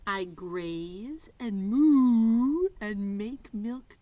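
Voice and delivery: loud and very slow; slow loud clue reading. Acted. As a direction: stretch each clue word out.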